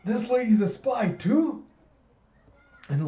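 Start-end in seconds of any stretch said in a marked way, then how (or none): 0.0-1.6 put-on high, slow child's question; high-pitched kid voice, stretched in amazement; Anya. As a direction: squeak it out slowly, rising. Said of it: "And Anya's like".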